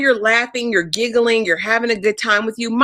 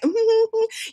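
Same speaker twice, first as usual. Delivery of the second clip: high, closed-mouth put-on giggle; high humming fake laugh; mock giggle; 12 semitones higher, 3 dB quieter than usual. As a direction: imitate a smitten little titter. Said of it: "she's back to smiling at this fool".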